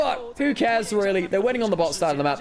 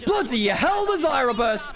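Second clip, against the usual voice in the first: excited shout; 12 semitones higher, 4 dB louder than usual. Real, not acted. Caster reacting to a big play.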